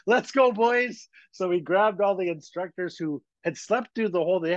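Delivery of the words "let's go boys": hurried driver. Retold or imitated. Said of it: "He goes".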